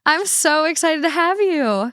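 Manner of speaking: drawn-out excited hello; excited welcome. Real, not acted. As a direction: gush over your guest. Host welcoming guest.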